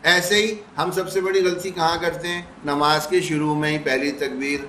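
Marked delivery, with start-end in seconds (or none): none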